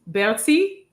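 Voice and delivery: a clumsy attempt at a French accent on the name Bertie; bad French accent. Host introducing a guest, trying a French accent and missing. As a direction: say Bertie with a failed French accent, lightly rolled. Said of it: "I tried to say that with a French accent and it went real bad".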